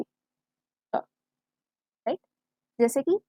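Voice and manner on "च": "च" breathed out as a bare unvoiced sound; sound demo. Shown as a demonstration. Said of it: "च की sound आएगी".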